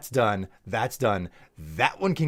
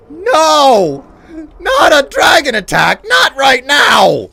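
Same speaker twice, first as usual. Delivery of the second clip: loud, high wailing cry; anguished shout; 13 semitones higher, 13 dB louder, slower than usual. Real, not acted. Streamer wailing loudly as a dragon attacks. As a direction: scream at the dragon in despair.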